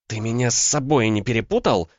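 high-pitched indignant comeback; flaring up. A narrator's reading. Woman throwing his reproach back, flaring up. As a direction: flare up at the accusation. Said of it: "взвилась Ванда".

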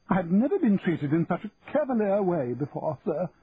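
protest, deeply offended; affronted auctioneer protesting; indignant. A narrator's reading. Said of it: "jumping up angrily".

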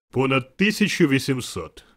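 loud, grand spirit voice; booming answer. A narrator's reading. The spirit answering Scrooge about its brothers, booming voice. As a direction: declare it at full volume.

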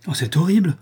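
a shocked, rising outburst; horrified cry. Acted. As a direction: cry out in horror. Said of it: "s'écria le comte".

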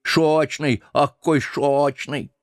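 put-on slow, lisping toothless old man; very old man mumbling. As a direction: mumble it with no teeth, slowly. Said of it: "прошамкал".

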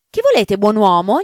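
a wary servant calling out; brusque caretaker. Acted. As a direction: challenge the stranger at the gate. Caretaker greets at the gate.

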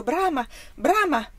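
loud, high cries, read with alarm; horrified cry. A narrator's reading. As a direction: cry the name out in horror. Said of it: "indietreggiarono inorriditi, gridando". (grido inorridito)

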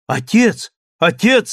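high, loud cry to her father; girl's scream. A narrator's reading. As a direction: shout for father in sudden panic. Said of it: "закричала девушка".

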